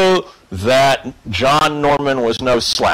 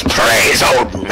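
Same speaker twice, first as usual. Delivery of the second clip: battle cry; 6 semitones lower than usual. Retold or imitated. Forkbeard leaping up in the temple, shouting his battle cry. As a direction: roar it as you leap from the shroud. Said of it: "leaps up and goes".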